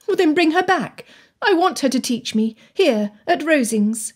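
high-pitched, pressing Anne; insistent young Anne. Acted. Anne's pleading dialogue in narration.

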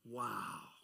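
usual speaking voice

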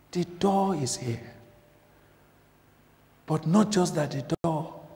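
ordinary delivery

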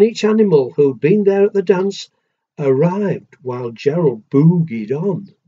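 plain reading voice

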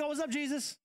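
high, breezy dude voice greeting Jesus; high laid-back disciple greeting Jesus; casual disciples. Pretend. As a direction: play it like a relaxed buddy saying hi. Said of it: "they should be like".

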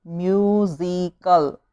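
musical drawn out slowly, split into syllables. Shown as a demonstration. Teacher counting syllables for pupils, musical said slowly in parts. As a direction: break musical into slow, separate syllables. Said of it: "three syllable".

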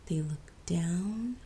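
slow whispered looking down; suspense whisper. A narrator's reading. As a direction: hush it, build dread. Spooky story whisper.

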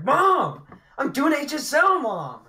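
put-on high yelling kid voice; shouting back. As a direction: yell back at mom, annoyed. Gamer kid yelling back at mom.

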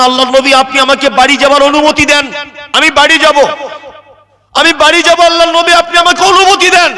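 high, impassioned begging; tearful plea. Acted. Jaber begging the Prophet to let him go home, high tearful pleading. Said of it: "চোখ দিয়ে ঠপ ঠপ করে পানি পড়ছে".

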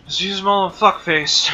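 rally the charge on the boss; excited shout. Real, not acted. Excited attack call in game.

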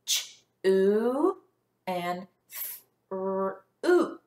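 "ch-oo" and "f-r-oot" pulled apart sound by sound; segmented words. Shown as a demonstration. Teacher stretches words so the class hears "oo", segmented. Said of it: "Listen again".

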